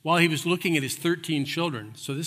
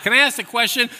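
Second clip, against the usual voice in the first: loud, higher call for attention; 6 semitones higher, 7 dB louder, faster than usual. Pretend. Man butting into a tour group, calling out loud. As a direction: shout the question across the room. Imagines raising hand on tour.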